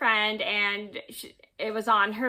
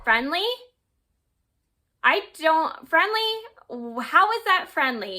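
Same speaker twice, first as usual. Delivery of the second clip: higher-pitched, disbelieving retort; incredulous; 5 semitones higher than usual. Retold or imitated. Teller replying to friend who defended the guy, incredulous. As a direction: echo the word back in retold disbelief.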